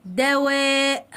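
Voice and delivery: very slow, held vowel; drawn-out model word. Shown as a demonstration. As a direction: stretch the example word for pupils. Teacher models example word. (نطق ممدود للكلمة)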